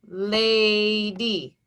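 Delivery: "Lady" stretched slowly into lay, dee; pounded syllables. Shown as a demonstration. Teacher pounding out syllables for pupils, "Lady" split slowly in two. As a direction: give each syllable of "Lady" a long, even beat. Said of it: "Two pounds, two syllables".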